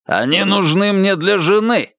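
heated accusation without shouting; barked reproach. A narrator's reading. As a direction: throw it back as an indignant challenge. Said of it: "рявкнул тренер Уитсток".

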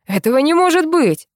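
high, agitated cry of disbelief; frantic, high-pitched outrage; raging. A narrator's reading. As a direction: rant it from upstairs, beside yourself. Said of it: "бесился наверху хозяин".

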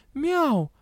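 high-pitched little mew; kitten meow. A narrator's reading. Kitten answering the dragon, meowing. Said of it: "ответила кошечка".

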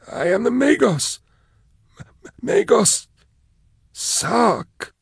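a man who has forgotten how to speak; broken prisoner voice. Acted. Caged prisoner pleading to be recognised, high halting voice.

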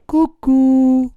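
high sing-song cuckoo jeer; mocking taunt. A narrator's reading. Puppet teasing the boys who threaten him, mocking. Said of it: "in segno di canzonatura".

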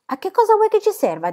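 put-on high, let-down child question; disappointed boy. A narrator's reading. Tom asks Pippi what the tin is for, high and deflated.

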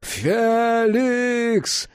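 high, long-held name scream; desperate cry. A narrator's reading. Jack begs Felix a final time, desperately crying out. Second, escalated plea to Felix.

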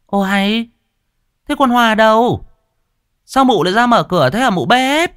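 high, loud, irritated lady of the house; shrill-free but piercing high annoyed mistress; haughty mistress. A narrator's reading. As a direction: snap at the cook from above. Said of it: "vẻ mặt khó chịu".

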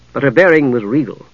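ordinary delivery, nothing marked

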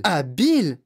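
put-on old man's scoffing exclamation; indignant exclamation. A narrator's reading. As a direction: throw the word back in disbelief. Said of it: "s'exclama le vieil homme".

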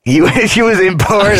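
can't believe the word she just heard; laughing disbelief. Real, not acted. Reacting to the co-host's word for the babysitter, laughing in disbelief.

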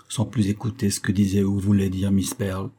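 plain reading voice

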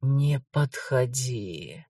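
slow, low, hushed warning; quiet whisper. Acted. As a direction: breathe it out as a quiet threat. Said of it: "тихим шёпотом велела".

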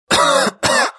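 rough, raspy cough; hoarse cough. Acted. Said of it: "Хрипло закашлял Дэн".

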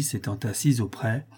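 ordinary delivery, nothing marked

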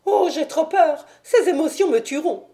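high, shaky almost-sobbing fear; a nervous man half weeping with fright; high-pitched whimpering panic; near tears with fright. Acted. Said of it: "Il pleurait presque dans sa frayeur".

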